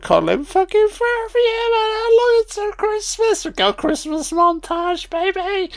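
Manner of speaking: very high, squeaky crying voice; tearful squeal. Real, not acted. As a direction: squeal it out, overcome with tears. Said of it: "I'm still crying".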